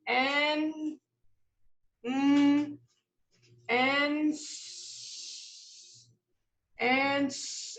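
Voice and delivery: "Answer" broken into "a", "n", "s-"; sounding out. Shown as a demonstration. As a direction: stretch each sound of "answer" slowly. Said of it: "I hear R".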